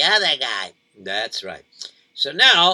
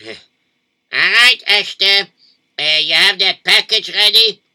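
eager, louder husband; lively husband voice; 4 semitones higher, 7 dB louder than usual. Acted. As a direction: call out to the wife. Husband asks wife for package.

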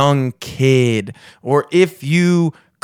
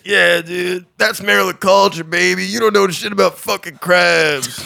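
mock bro voice; 5 semitones higher than usual. Mock Twitter user voice, acted quote.

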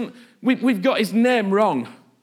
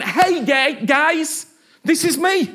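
raised, higher declaration; bold self-revealing Jesus; 5 semitones higher, 3 dB louder, slower than usual. Acted. Jesus baring himself before the disciples, high and emphatic. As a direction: announce yourself openly, pitched up. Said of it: "took his clothes off and went".

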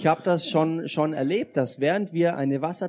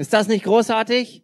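excited; 7 semitones higher, 7 dB louder than usual. Real, not acted. Interpreter echoes excitement.

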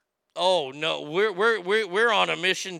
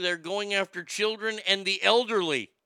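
normal talking voice

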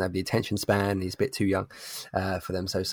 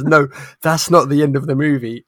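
louder, higher acted protest; mock argument, pitched up; insistent retort; 5 semitones higher, 8 dB louder than usual. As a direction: imitate a child firmly contradicting. Acted argument with his daughter.

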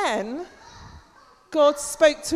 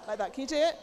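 normal talking voice

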